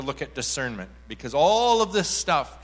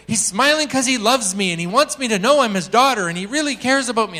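high, loud rush of joy; manic excitement; 8 semitones higher, 9 dB louder than usual. Retold or imitated. Woman telling why God is smiling, manic excitement. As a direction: imitate her pouring out the answer. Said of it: "she was manic".

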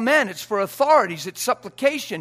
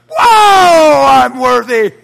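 shouted comeback, laughing; 3 semitones higher, 13 dB louder, much slower than usual. Acted.

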